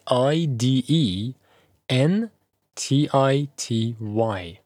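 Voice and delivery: slow spelling of i d e n t i t y, letter by letter; name each letter slowly and separately; spelled out letter by letter. Shown as a demonstration. Teacher spelling identity letter by letter for the class. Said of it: "اسپلینگش به این صورت هستش".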